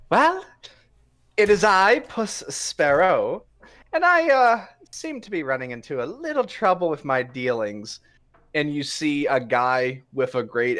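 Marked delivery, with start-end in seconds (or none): 0.1-8.0 high, theatrical rogue greeting; swashbuckling cat rogue. Acted. GM voicing a rogue greeting Zenith, high and theatrical. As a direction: play the dashing cat thief, grandly. Puss introduces himself grandly.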